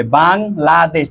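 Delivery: "Bangladesh" in three beats, bang, la, desh; slow, separated syllables in "Bangladesh"; split into syllables. Shown as a demonstration. Teacher counting three parts for students, "Bangladesh" syllable by syllable. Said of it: "তিন ভাবে".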